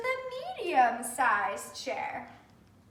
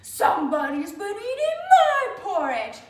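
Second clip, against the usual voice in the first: loud, big bear voice, a little higher; growly father bear; 6 semitones higher, 8 dB louder than usual. Acted. Father bear finding his porridge touched, big and loud. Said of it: "great big growly voice".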